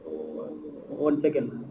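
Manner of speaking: barely audible, slow, off mic; faint voice far from the mic; very quiet. Real, not acted. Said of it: "your voice is feeble".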